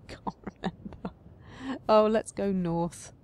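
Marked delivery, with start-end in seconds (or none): 0.1-1.1 whispered admission; whispered confession of forgetting. Real, not acted. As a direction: whisper it sheepishly. Whispered self-aside.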